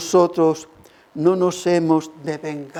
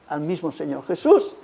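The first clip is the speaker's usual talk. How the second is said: ordinary talk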